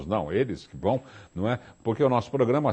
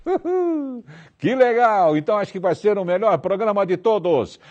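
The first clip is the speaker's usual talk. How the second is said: excited cheer; 8 semitones higher than usual. Real, not acted. Host cheers crew's yes.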